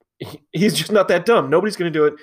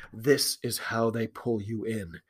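slow, soft and low; low ominous aside; 6 semitones lower, 9 dB quieter, slower than usual. Retold or imitated. Fan reading his match notes, low ominous aside. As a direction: drop right down, slow and foreboding. Reads out an ominous written note.

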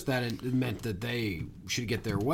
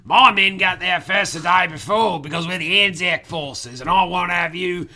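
blustering British general; 5 semitones higher, 6 dB louder than usual. Acted. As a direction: declaim like an indignant British officer. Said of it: "this British general walks out".

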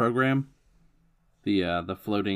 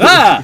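yelp; 12 semitones higher, 14 dB louder than usual. Acted. Jumps off building.

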